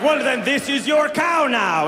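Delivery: put-on lightly done Dutch accent; indignant farmer with mock Dutch accent. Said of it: "That sounded Dutch".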